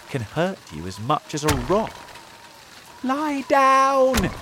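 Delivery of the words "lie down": high, loud warning cry; urgent shout. A narrator's reading. James warns friends as hail flies, shouting. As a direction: yell for everyone to take cover. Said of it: "shouted James".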